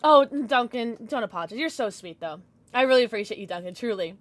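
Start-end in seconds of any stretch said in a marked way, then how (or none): none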